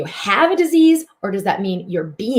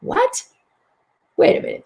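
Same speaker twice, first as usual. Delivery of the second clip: high, sudden what, wait a minute; mock alarm at a strange idea; startled double take; 11 semitones higher than usual. Healer voicing the startled mind, high and sudden. Mind's startled reaction, self-voiced.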